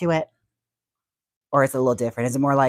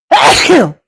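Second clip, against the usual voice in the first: a loud, high sneeze; 13 semitones higher, 15 dB louder than usual. Real, not acted. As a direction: sneeze loudly into the mic. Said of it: "oh, I gotta sneeze".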